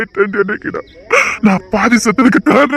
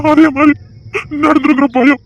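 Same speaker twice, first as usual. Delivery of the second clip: voice breaking; 5 semitones higher than usual. Acted. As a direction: let the fear crack the voice. Father breaks down in fear. (மகளுக்காக உடைந்த குரலில் அழுகை)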